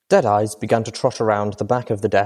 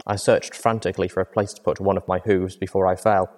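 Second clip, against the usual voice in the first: ordinary delivery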